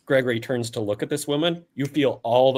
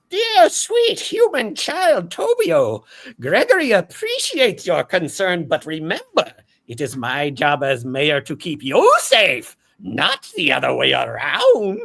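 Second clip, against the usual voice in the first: put-on gentle orb to a child; 8 semitones higher, 6 dB louder than usual. Gregory the orb talking sweetly to little Tobio. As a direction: coo kindly to a small boy.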